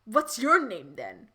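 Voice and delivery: narrated mild snap; snapped question. A narrator's reading. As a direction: snap it lightly, as a story character. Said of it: "she snapped".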